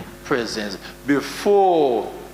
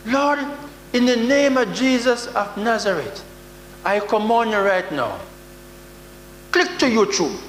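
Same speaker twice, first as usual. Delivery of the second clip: mock pastor command; 7 semitones higher than usual. Preacher mocking pastors who command God, hammed-up booming command. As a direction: ham up a preacher ordering God to turn on the TV.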